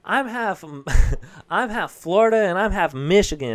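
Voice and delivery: put-on high, loud proud kid voice. Son telling the whole class, high proud voice. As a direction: announce it like a proud little boy. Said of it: "he was like".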